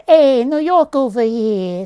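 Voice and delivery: loud, slow Italian-American New Yorker; New York gangster accent. Acted. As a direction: put on a drawn-out New York wiseguy accent. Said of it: "Italian gangster New Yorker kind of accent".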